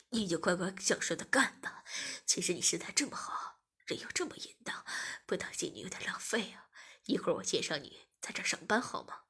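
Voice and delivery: low taunting male character voice; male masseur figure, taunting. A narrator's reading. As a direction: speak low, taunting the listener.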